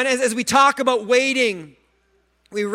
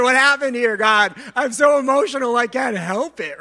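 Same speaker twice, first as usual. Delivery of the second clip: hammed-up whiny plea to God, louder; mock emotional lament; 4 semitones higher, 4 dB louder than usual. Preacher mocking his own drama, complaining to God. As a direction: play the overly emotional one crying out. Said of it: "Sometimes we wonder".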